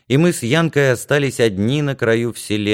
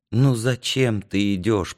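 ordinary delivery, nothing marked

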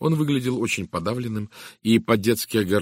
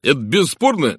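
loud, higher-pitched admiration; exclaiming; 5 semitones higher, 7 dB louder than usual. A narrator's reading. Inspector congratulates Poirot on his evidence, exclaiming. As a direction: praise it with loud enthusiasm. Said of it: "воскликнул он".

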